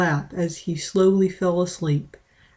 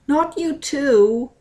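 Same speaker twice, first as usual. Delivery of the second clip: crying; 7 semitones higher, slower than usual. Acted. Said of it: "Mint cried more".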